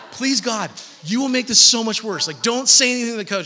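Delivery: begging. Retold or imitated. Embarrassed kid begging mom not to talk to coach, loud plea. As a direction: beg your mom to stop, loud and urgent.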